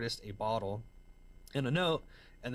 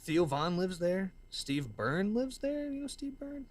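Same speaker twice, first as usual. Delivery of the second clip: excited name-dropping; 9 semitones higher, slower than usual. Real, not acted.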